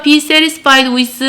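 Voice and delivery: very slow, measured teacher's model; slow choral-drill delivery; repeat-after-me model. Shown as a demonstration. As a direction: space the words out for the class to copy. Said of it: "따봐".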